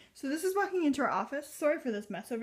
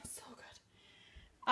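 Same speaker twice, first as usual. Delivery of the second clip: whispering; 12 dB quieter than usual. Real, not acted. Savouring the candle's scent.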